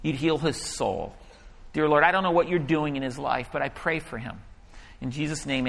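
hushed, low retold prayer; simple prayer. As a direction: pray quietly and plainly for him. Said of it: "And I simply pray".

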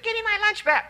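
put-on a whiny child wanting his lunch back; kid's voice. The boy whines at Andrew, high kid voice. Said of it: "the kid's like".

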